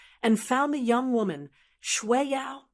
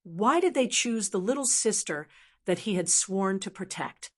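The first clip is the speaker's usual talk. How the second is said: plain reading voice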